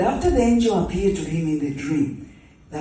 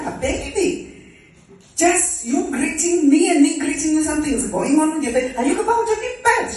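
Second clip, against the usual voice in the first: high, rushed amazement; astonished questioning; 8 semitones higher than usual. Acted. Mary asking Elizabeth about her leaping baby, astonished questioning. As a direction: blurt it out, pitched up and quickening.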